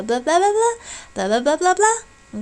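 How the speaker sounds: put-on high-pitched baby babble; babbling baby. Shown as a demonstration. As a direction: babble like an infant trying out sounds. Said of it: "sound more like blah".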